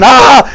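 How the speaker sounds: loud panicked yell; mock man's yell of panic; retold scream. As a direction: yell back in comic alarm. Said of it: "he started screaming".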